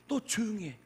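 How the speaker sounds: a touch of an irritated crowd; scolding crowd. Retold or imitated. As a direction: lightly act the crowd telling him off. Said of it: "사람들이 이 바디메오에게".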